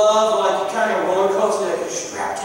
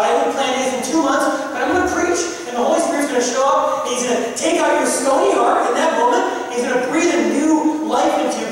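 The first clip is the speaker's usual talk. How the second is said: louder, fast, almost preaching; urgent insistence; 4 semitones higher, 6 dB louder, faster than usual. Retold or imitated. Priest insisting she come to his first mass, loud and fervent. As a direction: press her until she agrees.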